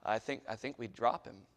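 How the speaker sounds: ordinary talk, nothing marked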